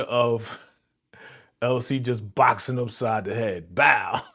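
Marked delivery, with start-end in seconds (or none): 3.7-4.2 loud, sudden impact sound; big vocal smack; imitated punch noise. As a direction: punch out a comic hit effect.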